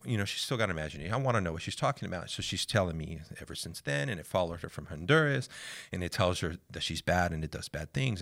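normal talking voice

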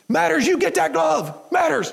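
high, slow furious bark; coach barking. Retold or imitated. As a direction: bark the order at a hurt kid. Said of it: "I can hear him barking".

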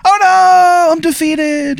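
high, long mock wail of defeat; hammed-up dying-villain cry; defeated demon. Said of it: "you hear him just go".

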